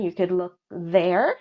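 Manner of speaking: ordinary talk, nothing marked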